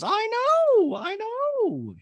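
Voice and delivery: very high drawn-out coo; Dorit impression. Dorit agreeing with Garcelle about yellow, long squeaky voice. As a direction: stretch each word way up high. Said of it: "Dorit goes".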